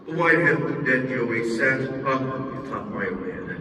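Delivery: ordinary delivery, nothing marked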